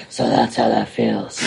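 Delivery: low, brooding superhero imitation; exaggeratedly grave, low retold line; mock Batman voice. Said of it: "when he said up by himself".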